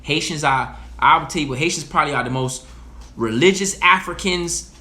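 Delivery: usual speaking voice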